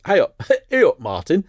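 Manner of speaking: laughing put-on Yorkshire hello to the listener; Yorkshire accent. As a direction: play a cheery Yorkshireman calling hey up. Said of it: "I don't know why I put that accent on".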